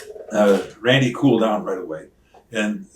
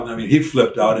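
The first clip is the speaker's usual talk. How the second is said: normal talking voice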